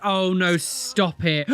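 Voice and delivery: ordinary delivery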